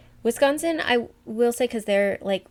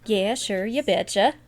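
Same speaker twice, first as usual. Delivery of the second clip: put-on Minnesotan accent; 4 dB louder than usual. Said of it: "the more they have accents".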